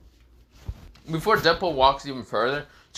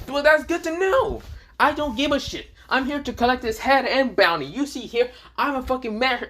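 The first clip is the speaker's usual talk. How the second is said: high-pitched, brazen comeback; brash mercenary; 10 semitones higher than usual. Acted. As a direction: push back hard, not caring. Deadpool loudly brushing off Haku.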